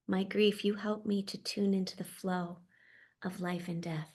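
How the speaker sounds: normal talking voice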